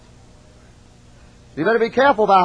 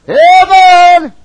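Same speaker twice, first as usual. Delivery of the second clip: shouted amen; 11 semitones higher, 11 dB louder than usual. Real, not acted. Preacher's excited exclamation.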